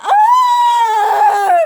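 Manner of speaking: long, very high scream at full force. Retold or imitated. Showing the camera the scream he does, long and high. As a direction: let out one long piercing scream. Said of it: "Now that screaming is".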